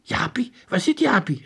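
high-pitched urgent question; startled girl demanding news; calling out. A narrator's reading. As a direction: ask eagerly, voice jumping up. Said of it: "riep Monica".

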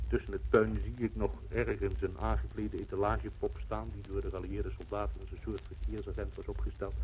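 ordinary delivery

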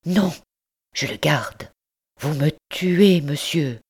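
heartbroken, slow cry on vous me tuez; accent déchirant. Acted. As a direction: refuse him with anguish. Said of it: "d'un accent déchirant". (mère déchirée qui refuse de rendre l'enfant)

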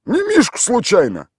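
high, put-on village voice; gruff local man answering a stranger; rough villager. A narrator's reading. Tolik answers the city stranger in a rough village voice.

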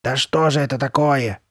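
exasperated, baffled question; perplexed frustration; bewildered. A narrator's reading. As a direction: ask it in mounting bewilderment. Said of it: "недоумевала Карли Бет".

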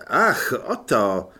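put-on slow, drawn-out vampire accent; a shy vampire, lingering on the words; Otto's vampire voice. Vampire greets the soldiers, slow and accented. Vampire Otto speaks slowly.

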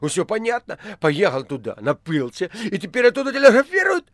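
high, loud burst of realisation; excited shouting. A narrator's reading. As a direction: exclaim in triumphant excitement. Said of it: "возбуждённо кричал администратор". (возбуждённо, высоко и громко)

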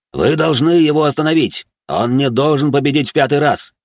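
agitated high-pitched yell; heated shout, pitched well up; director shouting. Acted. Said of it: "закричал директор".

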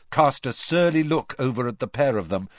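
ordinary delivery, nothing marked

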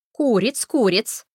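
loud, grand confirmation; a touch of pompous dignity; majestic. A narrator's reading. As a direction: state it with regal certainty. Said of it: "величественно кивнула Бренда".

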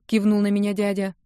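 usual speaking voice

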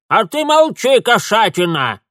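put-on shrill high old crone shout; shouting old woman. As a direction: yell fearlessly at the cat. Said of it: "бесстрашно прикрикнула старуха". (старуха прикрикивает)

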